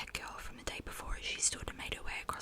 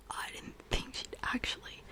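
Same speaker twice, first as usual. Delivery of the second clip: usual speaking voice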